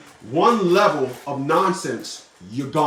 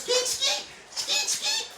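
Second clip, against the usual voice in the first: squeaky skeet noise; 6 dB quieter, much slower than usual. Pretend. Mimicking ejaculation sound effect.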